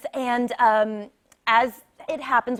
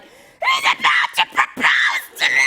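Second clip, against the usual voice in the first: much higher, laughing squeal; excited shout. Retold or imitated. Telling the host her thrilled inner thought, squealing high. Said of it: "I'm like".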